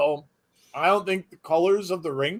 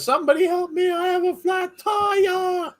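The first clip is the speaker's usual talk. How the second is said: mock distress cry. Chat read in mock distress.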